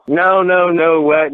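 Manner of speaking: high, incredulous no no no what; imitated shocked protest; mock disbelief. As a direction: act out the stunned denial. Retold official's denial.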